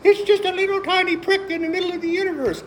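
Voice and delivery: high, mocking scientist voice. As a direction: sneer like a dismissive scientist. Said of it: "these scientists like".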